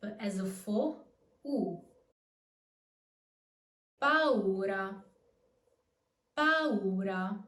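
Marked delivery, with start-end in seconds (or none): 1.5-1.8 U as a full vowel of its own; normal U. Shown as a demonstration. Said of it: "the U is pronounced normally". 4.0-7.4 "Paura" with the U a full vowel; slow teacher demo of "paura", U sounded fully. Shown as a demonstration. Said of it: "the U is pronounced normally".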